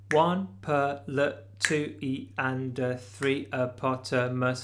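slow, evenly spaced rhythm count; counted slowly. Shown as a demonstration. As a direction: spell out each count word slowly and deliberately. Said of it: "So slowly, that would sound like this".

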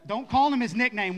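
loud, playful shout to the room. Real, not acted. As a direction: call it out big and teasing. Jokingly shouting to the crowd.

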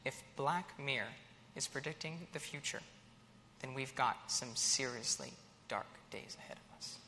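low, hushed and ominous; mock grave. Host warning the audience of dark days, mock grave. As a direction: drop to a soft, foreboding warning.

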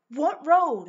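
loud, high-pitched bewildered question; baffled retort. Retold or imitated. Caller to lost taxi driver, baffled and loud. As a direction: throw it back in loud disbelief.

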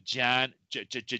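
ordinary talk, nothing marked

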